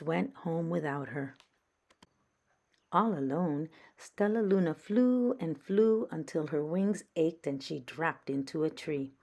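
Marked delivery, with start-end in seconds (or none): none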